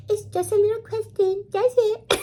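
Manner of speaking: very high, tiny put-on cutesy voice; little cutesy voice. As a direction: exaggerate the sweet, baby-doll innocence. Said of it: "put on my little cutesy voice".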